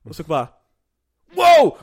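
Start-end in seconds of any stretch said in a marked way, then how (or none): 1.3-1.8 very high, loud jump-scare shriek; startled yelp. Retold or imitated. Teammates startled by the invisible player, shrieking high. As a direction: yelp as if someone appeared from nowhere. Startled when Kuroko appears.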